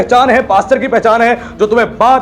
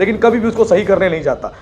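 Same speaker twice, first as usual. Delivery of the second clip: ordinary delivery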